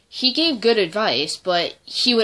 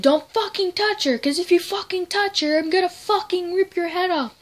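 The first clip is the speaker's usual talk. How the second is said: much higher, furious threat; threatening Leah; 12 semitones higher, 3 dB louder than usual. Retold or imitated. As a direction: snarl it like a protective friend. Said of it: "she was like".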